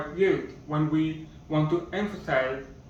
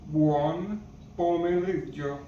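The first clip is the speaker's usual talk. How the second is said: buon pomeriggio said slower, every syllable clear; slower repeat; slower than usual. Shown as a demonstration. Teacher repeating buon pomeriggio for learners, slowly. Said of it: "Good afternoon in Italian is".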